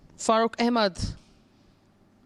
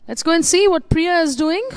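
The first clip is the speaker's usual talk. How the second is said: excited; 10 semitones higher, 10 dB louder than usual. Real, not acted. Handing over to next putt.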